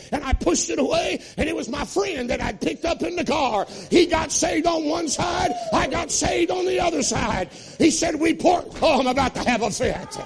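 high, loud, fast-rising revival preaching; preaching at full force. Acted. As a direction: shout the testimony to the rafters.

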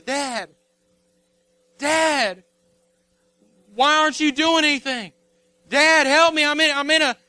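high, loud cry for Dad's help; pleading son. Acted. Son in a pit calling to his dad, pleading. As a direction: beg urgently, voice pushed up high. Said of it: "he's pleading and he's begging".

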